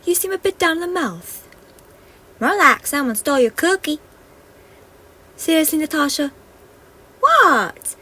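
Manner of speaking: high, loud bickering fairies; fairy voices. Acted. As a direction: fairies teasing each other.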